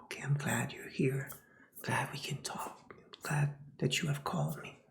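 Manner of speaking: soft whispered offer of water; gentle caring. Retold or imitated. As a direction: whisper it kindly to someone crying.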